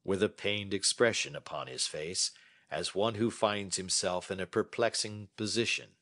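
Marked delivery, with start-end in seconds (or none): none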